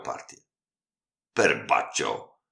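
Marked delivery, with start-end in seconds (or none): 1.3-2.4 slow, drawn-out Italian oath; a defeated host's groan of surprise; dismayed exclamation. A narrator's reading. The host, beaten at chess, slaps his bald head, slow dismayed oath. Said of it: "zawołał gospodarz, bijąc się po łysinie".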